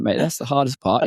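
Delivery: ordinary talk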